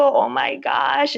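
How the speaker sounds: high, slow "oh my gosh"; overwhelmed. Retold or imitated. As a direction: gasp it out in disbelief. Panicking at joining the fellowship.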